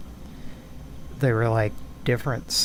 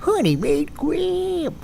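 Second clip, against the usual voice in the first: very high, slow sneering imp; impish voiceover guy; 13 semitones higher, much slower than usual. Pretend. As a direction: purr the brand name like an imp. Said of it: "I'm an impish voiceover guy".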